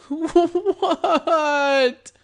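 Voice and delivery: high, loud, panicked squeal; terrified. A narrator's reading. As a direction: squeak in terror. Terrified victim under gun.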